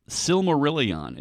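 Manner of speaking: Silmarillion drawn out slowly, ending in a full "ee-on"; careful correct pronunciation. Shown as a demonstration. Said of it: "that's not how it's supposed to be pronounced".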